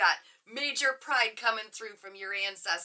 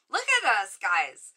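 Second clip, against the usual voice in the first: excited; 6 dB louder than usual. Real, not acted. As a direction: burst out with delight at the group. Delighted at the group.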